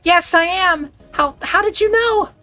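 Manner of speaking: high, urgent back-and-forth calls; excited calling. Acted. Siblings calling to each other, high urgent calls. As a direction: reach him across the distance.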